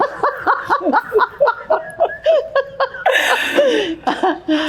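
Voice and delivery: long high-pitched laugh; high laughing fit. Real, not acted. Laughing at herself in the story, high laugh.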